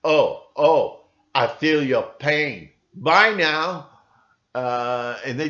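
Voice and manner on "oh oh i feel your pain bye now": slow, loud, hollow mock sympathy; fake sympathy. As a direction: sound like a computer faking feelings. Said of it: "It's just".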